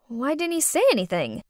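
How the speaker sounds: plain reading voice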